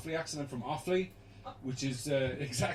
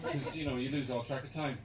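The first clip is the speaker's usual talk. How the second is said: normal talking voice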